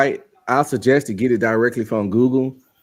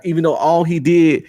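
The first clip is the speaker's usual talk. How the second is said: ordinary talk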